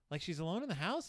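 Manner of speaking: normal talking voice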